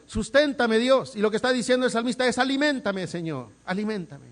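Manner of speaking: loud, high, impassioned preaching; fervent preaching. Acted. Preacher pleading before his congregation, fervent and loud. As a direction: preach it out with full fire.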